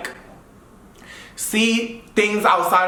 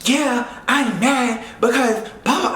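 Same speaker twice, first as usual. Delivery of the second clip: slow, higher mocking tone; mock mad friend; 4 semitones higher, slower than usual. Imitating the friend insisting she's mad, higher. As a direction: lightly mimic her anger. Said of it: "And she's like".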